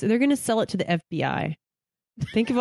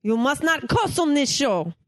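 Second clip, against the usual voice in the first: much higher mock Italian mom voice; 6 semitones higher than usual. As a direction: nag like an Italian mother. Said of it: "Italian mom".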